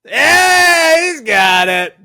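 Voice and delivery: loud, high cheer; excited shout. Real, not acted. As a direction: whoop it out. Cheers correct answer.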